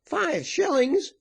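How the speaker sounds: very high, drawn-out disbelief; slow high-pitched outcry; scornful exclamation. A narrator's reading. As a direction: exclaim it slowly in a high, incredulous voice. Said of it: "exclaimed the woman".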